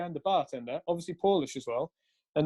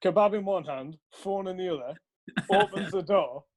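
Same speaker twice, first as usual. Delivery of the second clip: ordinary talk, nothing marked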